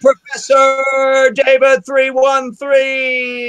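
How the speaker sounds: slow, high, shouted announcer voice like Kermit; hammed-up muppet announcement; Kermit the Frog. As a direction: announce the patron like Kermit opening the show. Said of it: "That was like Kermit the Frog".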